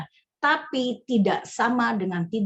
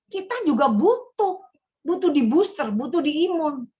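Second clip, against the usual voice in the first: preaching emphasis; 5 semitones higher, 5 dB louder than usual. Real, not acted. Preacher driving point home.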